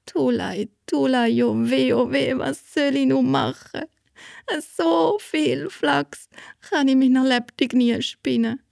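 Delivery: a mild storybook wail of despair; girl alone with the flax pile, mildly wailing; wailing lament. A narrator's reading. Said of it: "fängt sie an zu brüllen".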